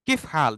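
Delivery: short "কিফ হাল", endings dropped; spoken Arabic form. Shown as a demonstration. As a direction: say "কিফ হাল" as two single syllables. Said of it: "দেখেন পরিবর্তন হয়ে যায়".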